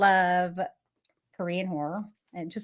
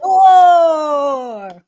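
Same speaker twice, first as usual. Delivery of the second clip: announcer shout; 7 semitones higher, 9 dB louder than usual. Acted. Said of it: "Say it with me, Crystal".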